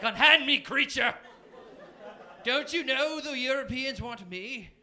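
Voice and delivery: high, grandly hammed-up haughty outburst, easing off; arrogant. Arrogant comic to a subway stranger, haughty outburst. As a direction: proclaim it like a preening diva. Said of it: "I was the most arrogant man".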